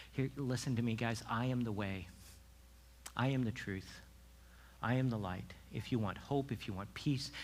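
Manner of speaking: soft, low, tender appeal; gentle invitation. Acted. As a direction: invite them in quietly and calmly. Voices Jesus gently inviting followers.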